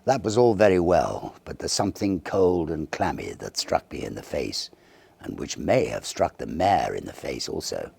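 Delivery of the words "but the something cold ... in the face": usual speaking voice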